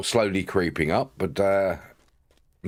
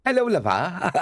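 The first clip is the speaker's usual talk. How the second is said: high, giggly mock Bristolian; badly faked Bristolian greeting breaking into laughter; Bristolian accent; 8 semitones higher than usual. Streamer laughing at own attempt, Bristolian accent. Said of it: "don't do a very good Bristolian accent".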